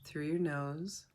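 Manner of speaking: calm meditation guide with long pauses between phrases; guided meditation. Real, not acted. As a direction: ease listeners through each breath, unhurried.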